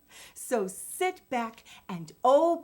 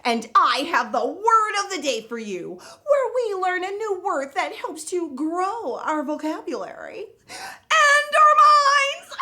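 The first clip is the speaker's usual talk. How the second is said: high, loud, giggly show host character; Miss Vocab; 7 semitones higher, 6 dB louder than usual. Acted. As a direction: play a bubbly kids' show host, laughing. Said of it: "I'm Miss Vocab".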